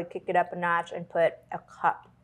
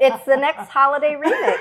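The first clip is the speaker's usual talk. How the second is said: laughing through the words; 6 semitones higher, 7 dB louder than usual. Real, not acted. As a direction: say it while cracking up. Both laughing, tipsy banter.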